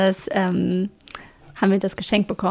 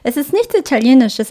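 normal talking voice

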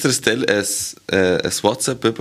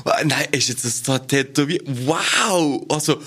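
hammed-up sweet-talk to a friend; mock ass-kissing praise; 5 semitones higher than usual. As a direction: gush over him like a suck-up. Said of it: "Sag's grad richtig".

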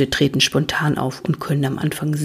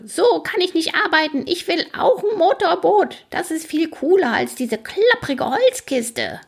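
very high, griping character voice; ranting ferryman; 13 semitones higher than usual. A narrator's reading. Ferryman demands a motorboat, high indignant rant. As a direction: complain indignantly in a squawky high pitch. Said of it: "schreit der Fährmann zurück".

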